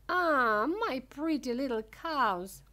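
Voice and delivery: loud, high, adoring exclamation; delighted cry. A narrator's reading. Wonka at his cows' door, crying out with delight. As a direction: burst out with loud affection. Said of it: "cried Mister Wonka".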